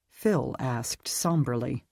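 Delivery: usual speaking voice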